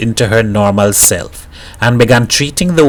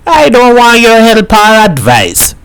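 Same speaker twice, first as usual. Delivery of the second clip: higher, louder, indignant snap; angry retort; 10 semitones higher, 5 dB louder than usual. Acted. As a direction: throw her help back at her. Woman snaps back at mother.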